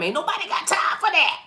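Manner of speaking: high-pitched comic outburst; exaggerated high voice; put-on catchphrase voice.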